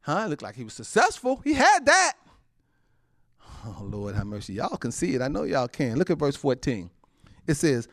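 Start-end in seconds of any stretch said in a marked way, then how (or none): 3.6-4.5 soft, low aside to God; hushed sigh. Real, not acted. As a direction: breathe it out quietly.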